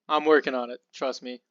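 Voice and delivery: ordinary talk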